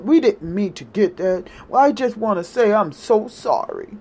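low, halting fake-contrite voice; mock apologetic voters.

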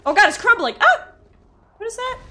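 high, loud panicked yelp; alarmed cry. Real, not acted. Player as the platform starts crumbling, alarmed cry. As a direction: blurt it out loudly in alarm.

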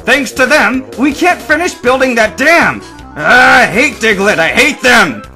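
loud, high-pitched rant; furious shouting. A narrator's reading. Worker rants about the unfinished dam, shouting in fury. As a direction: rage at full volume. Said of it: "the man shouted in a fury".

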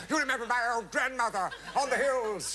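high, loud frantic appeal; begging your partner to play along; desperate plea. Retold or imitated. Actor turning to Sheila Hancock, high frantic plea. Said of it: "I was desperate".

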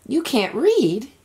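high, slow astonished question; can't believe what he's hearing; amazed question. A narrator's reading. Edward amazed pirates can't read.

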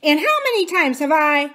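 high, loud nagging parrot; Kiki. A narrator's reading. Parrot nagging Aunt Polly from the passage, scolding. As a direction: scold like a bossy parrot, cut short. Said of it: "came Kiki's voice from the passage".

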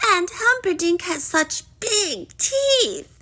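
high, whiny princess wail; drawn-up, high-pitched complaint. A narrator's reading. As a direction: wail it like a sulky young princess. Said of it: "wailed Poppy".

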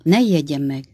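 plain reading voice

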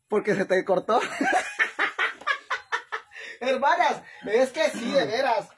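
loud, laughing talk through giggles. Real, not acted. Laughing to her sisters online, loud and giggly. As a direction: giggle through every word. Laughing fit while talking to viewers.

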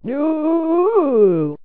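long, high "no" of a victim; high, wailing yell of protest; drawn-out scream. A narrator's reading. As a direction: stretch out a high cry of horror. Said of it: "Carlos screamed and yelled out again".

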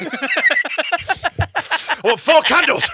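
high, slow comic customer voice; sketch character voice. Acted. Improvised sketch remake in voice.

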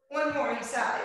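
normal talking voice

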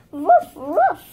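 two loud pretend woofs; puppy bark. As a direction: bark back eagerly as the puppy. Toy puppy answers by barking.

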